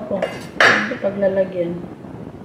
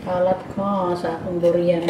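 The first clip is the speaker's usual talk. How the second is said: normal talking voice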